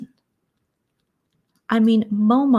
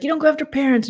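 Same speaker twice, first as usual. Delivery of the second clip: mocking Jen; 8 semitones higher, 3 dB louder, much faster than usual. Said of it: "Cemetery Jen's like".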